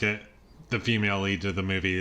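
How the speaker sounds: usual speaking voice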